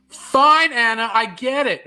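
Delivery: high, loud fake outburst; mock exasperation. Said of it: "No, I'm just kidding. I'm joking".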